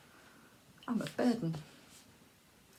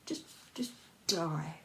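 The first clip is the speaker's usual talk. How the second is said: whispered urging; 3 semitones lower, 6 dB quieter than usual. Acted. Inner voice whispering to herself to die. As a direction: breathe it out like a dark inner voice.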